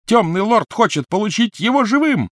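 high, loud echoing shout. Acted. Malfoy shouts at Crabbe across the huge room, high and loud. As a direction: bellow it across the hall. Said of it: "крик его разнёсся".

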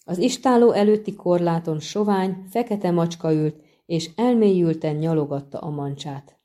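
ordinary delivery, nothing marked

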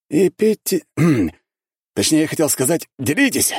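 нарочитая оговорка с покашливанием; поправиться с лукавой усмешкой; шутливая оговорка. Acted. (с хитрой оговоркой)

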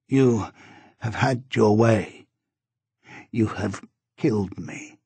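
slow, pausing between each word; halting, with difficulty. A narrator's reading. Dying wife to husband, slow and halting. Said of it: "said with difficulty, with pauses between the words".

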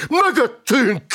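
high, loud alarm cry; screamed warning. A narrator's reading. Notary spotting the dead behind them, screamed warning. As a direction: yell it in alarm. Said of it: "ordította a jegyző".

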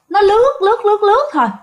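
high, sing-song repetition of lướt to evoke a gliding boat; eerie gliding repetition. Retold or imitated. Kể chiếc đò ma lướt đi, giọng cao lơ lửng. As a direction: let each lướt float up eerily.

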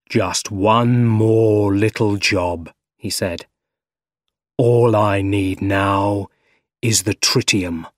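slow, drawn-out villain voice; slow menacing relish, words stretched; Doc Ock, scheming. A narrator's reading. Doc Ock alone finishing his fusion reactor, slow villain drawl.

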